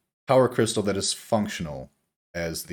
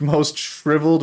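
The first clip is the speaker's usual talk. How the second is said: ordinary talk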